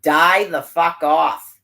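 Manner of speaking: loud and slow, each word spat; slow furious curse. Real, not acted. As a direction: drag it out with hatred. Furious rant at officials.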